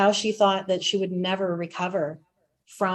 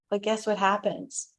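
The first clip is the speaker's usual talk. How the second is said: ordinary talk, nothing marked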